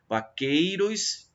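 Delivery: "vaqueiros" with the EI leaned on; stress on EI. Shown as a demonstration. Teacher showing students where the line's count ends, stressed EI. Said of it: "termina no ei".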